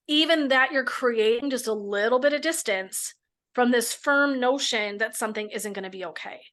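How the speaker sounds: ordinary talk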